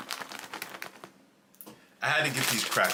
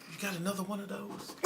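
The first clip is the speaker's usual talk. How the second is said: put-on soft, higher-pitched Southern accent; 6 semitones higher, 9 dB quieter than usual. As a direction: imitate her Southern accent, lightly and softly. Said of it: "In her Southern accent".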